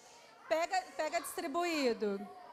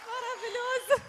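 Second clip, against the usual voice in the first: laughing; 6 semitones higher, 3 dB louder than usual. Real, not acted. Delighted by child's answer.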